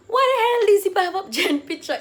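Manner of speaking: loud, high, incredulous demand; indignant outburst. Retold or imitated. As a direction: retell it as an outraged outburst. Retold outrage at friend's picture.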